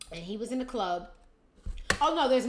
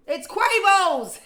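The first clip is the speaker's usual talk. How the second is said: much louder, slow, higher reveal; yelled punchline; 11 semitones higher, 14 dB louder, much slower than usual. Pretend. Shouting the twist to the viewers, loud. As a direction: belt out the reveal.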